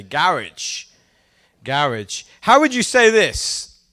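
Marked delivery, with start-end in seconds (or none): 0.0-2.2 GARAGE as GARR-idge; low-pitched "GARR-idge"; British garage. Shown as a demonstration. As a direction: stress the first syllable of garage, ending like "ridge". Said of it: "That's how I would say".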